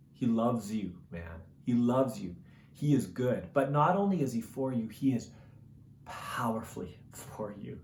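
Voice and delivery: soft, hushed preacher's appeal; quiet, earnest pastoral invitation; intimate sermon close. Acted. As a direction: lean in and plead gently with them.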